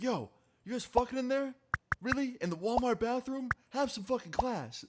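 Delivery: high-pitched mock yell at the stall; retold yelling. Guy in Walmart bathroom scolds the couple in the stall, mock yelling. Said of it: "So he starts yelling".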